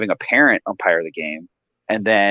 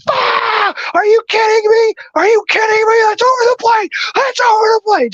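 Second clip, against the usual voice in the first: put-on high, loud drunk yell; retold furious shout; belligerent yelling; 4 dB louder than usual. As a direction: imitate a drunk dad screaming at the umpire. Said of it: "Just getting belligerent".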